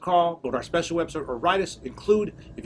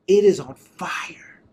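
loud, drawn-out astonishment; amazed exclamation; 3 semitones higher, 8 dB louder, much slower than usual. Retold or imitated. Retelling a woman feeling heat from his hand, loud amazement. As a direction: stretch it out slowly and loudly in amazement.

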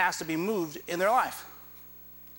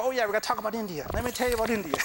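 fast, higher-pitched mock enthusiasm; eager preacher; 3 semitones higher, much faster than usual. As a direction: imitate yourself jumping eagerly into a story. Mimicking himself eagerly preaching about India.

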